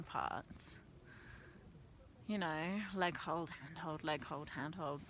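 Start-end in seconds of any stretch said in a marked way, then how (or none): none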